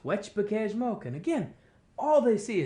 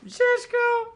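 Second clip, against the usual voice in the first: very high, louder teasing sing-song; mocking name-call; 5 dB louder than usual. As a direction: jeer his name. Animals mocking the muskrat.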